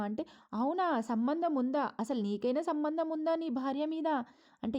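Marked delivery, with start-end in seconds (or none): 0.5-4.1 sarcastic taunt. Retold or imitated. Mother taunting son in quarrel.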